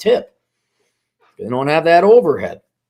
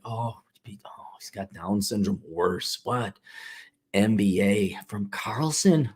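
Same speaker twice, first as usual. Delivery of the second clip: much softer, gossiping whisper; pitying whisper; 6 semitones lower, 10 dB quieter than usual. Pretend. Onlookers whispering pity about the Carlson MBA, hushed.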